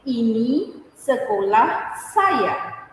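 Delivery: "Ini sekolah saya" said slowly, word by word; slow model sentence. Shown as a demonstration. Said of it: "we say".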